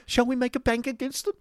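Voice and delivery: very high-pitched, overwrought question; hysterical. Acted. Miss Squeers seeks the Yorkshireman as partner, hysterically. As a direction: pipe it out on the edge of hysteria. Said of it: "said Miss Squeers hysterically".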